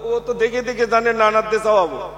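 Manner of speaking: ordinary talk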